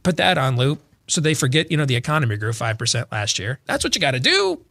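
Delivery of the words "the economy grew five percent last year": ordinary talk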